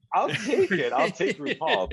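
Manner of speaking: high, laughing joke. Real, not acted. Guest joking to hosts about being outed, laughing high.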